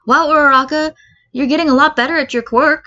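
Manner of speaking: Midoriya voice. Acted. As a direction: praise her like an eager boy. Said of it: "Midoriya says".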